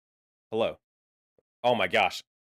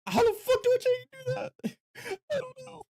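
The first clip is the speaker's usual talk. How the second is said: very high, squeaky exasperation; squeaky helpless outburst; high-pitched squeal. Real, not acted. Exasperated squeal at mic advice.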